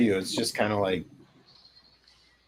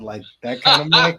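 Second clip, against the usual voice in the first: ordinary talk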